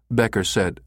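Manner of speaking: ordinary delivery, nothing marked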